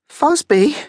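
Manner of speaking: put-on very high, questioning boy's squeak; squeaky. A narrator's reading. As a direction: squeak the name back, still nervous. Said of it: "still slightly squeakily".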